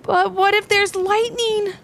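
high, slow, anxious question; frightened child. A narrator's reading. Ivy asks Dad about lightning, frightened. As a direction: ask slowly in a high, worried child's voice. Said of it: "making Ivy cower".

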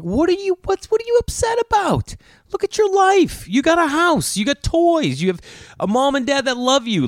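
high, incredulous scolding; exasperated parent. Retold or imitated. Parents to grouchy daughter, high exasperated scolding. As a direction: plead with a tantrum-throwing kid in disbelief.

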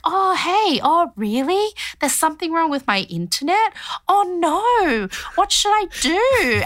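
fake-naive sister fooling a scammer; mock innocent phone voice. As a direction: act the clueless victim on the phone. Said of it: "And she was like".

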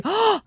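imitated high gasp; mock offended gasp. Acts out Michael taking offence.

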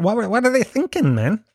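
normal talking voice

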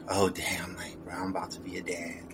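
low, hushed, half-whispered dread; very soft, low stunned mutter to yourself; stunned aside. Retold or imitated. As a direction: say it under your breath, as a sinking private thought. Acts out his stunned private thought.

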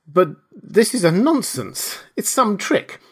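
high-pitched disbelief; baffled, refusing to be fooled; incredulous protest. A narrator's reading. Kemp refuses to believe it.

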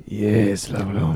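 low, soft mimicry; imitated booth lady. Co-host teasing about the lady's reply, low soft mimicry. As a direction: lightly mimic her reply, dropping low. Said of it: "Why did she say".

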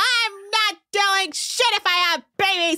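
high-pitched, worked-up tirade; hammed-up indignant outburst; mock hysterical rant. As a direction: rant high in an exaggerated imitation. Acted rant about having babies.

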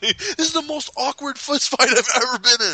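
high, laughing acted complaint; mock exasperation. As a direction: protest the awkwardness in a high voice. Acting out awkward fight quarrel.

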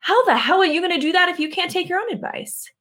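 a sudden wake-up call to herself; fired-up self-talk. Retold or imitated. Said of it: "that very night that I realized".

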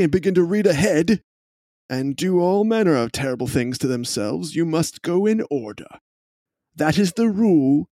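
prim lecturing gentleman. Acted. NPC lecturing on rules.

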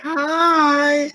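high, drawn-out "hi"; mock flirty greeting. Friend mimics the man waving for attention, mock flirty. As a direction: sing out a teasing high hello.

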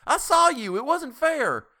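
high, loud angry shout. A narrator's reading. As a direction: shout the protest at full voice. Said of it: "Tessie Hutchinson shouted".

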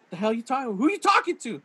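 high-pitched incredulous question; mock baffled demand. Retelling the husband's reaction to his wife, mock disbelief. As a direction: imitate a husband who cannot believe it. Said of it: "he's like, he's like".

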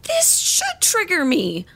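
indignant. Acted. Daughter insisting angrily.

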